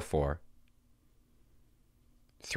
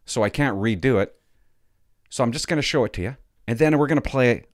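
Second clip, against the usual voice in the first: normal talking voice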